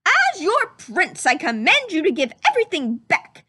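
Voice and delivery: declaring. Acted. Prince commands the soldiers to return the loot. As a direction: proclaim it like a royal order. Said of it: "he declared".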